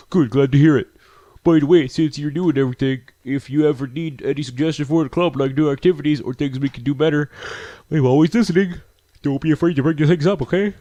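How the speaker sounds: lifted, upbeat character voice; peppy, helpful game girl; friendly club leader. Acted. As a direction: chirp it like an encouraging club leader.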